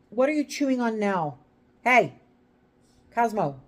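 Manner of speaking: sharp, high, loud call to a pet; scolding the dog. Real, not acted. Owner catches Cosmo chewing on something, scolding loudly.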